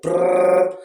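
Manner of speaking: rolled-lip motorbike engine noise; motorbike brr. Retold or imitated. Comparing someone to a motorbike, lip-trilled engine noise. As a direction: rev it like a motorbike with your lips. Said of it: "Don't be like a motorbike".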